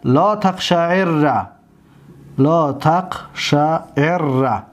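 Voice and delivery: لا تقشعر slowly, the rr held; teacher dictating لا تقشعر slowly; slow dictation. Shown as a demonstration. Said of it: "فعل نهیش چی است؟".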